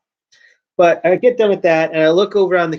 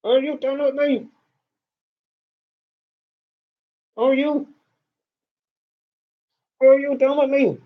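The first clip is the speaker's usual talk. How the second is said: higher, mock-patient question to the cat; sarcastic asking; 7 semitones higher than usual. Real, not acted. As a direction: ask the cat sarcastically if it's finished. Scolding the cat interrupting him.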